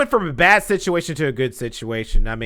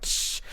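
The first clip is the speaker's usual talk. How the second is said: short, soft voiceless crash; a quick mouth-made smash; shattering glass noise; 11 dB quieter than usual. Retold or imitated. Showing what the breaking backboard sounded like, glass noise. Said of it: "That's what it sounded like".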